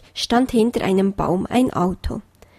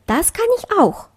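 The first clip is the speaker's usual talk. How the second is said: bunny's boast; 10 semitones higher than usual. A narrator's reading. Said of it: "sagte Kleinöhrchen".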